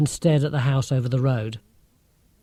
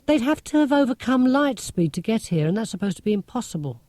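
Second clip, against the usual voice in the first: put-on higher, lively, lecturing girl's voice; Marcia, know-it-all girl; 8 semitones higher than usual. A narrator's reading. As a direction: play a clever, sceptical schoolgirl. Marcia explains light speed.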